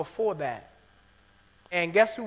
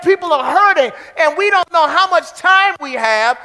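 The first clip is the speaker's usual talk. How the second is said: loud, high, ringing sermon climax; full-force preaching; 10 semitones higher, 12 dB louder than usual. Acted. Preacher urging the congregation, in full-force preaching. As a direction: preach it out to the whole church.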